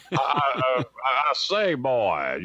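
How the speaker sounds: slow, lightly hammed southern general drawl; Foghorn Leghorn southern drawl. [name] redoing his Foghorn Leghorn bit for the hosts, slow southern drawl. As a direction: drawl it slow like a cartoon rooster. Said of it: "He went into Foghorn Leghorn?".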